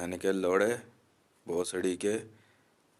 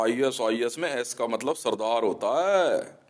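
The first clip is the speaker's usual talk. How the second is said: mocking mimicry; 4 semitones higher, 5 dB louder, slower than usual. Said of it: "कह रहा".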